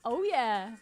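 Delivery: ordinary delivery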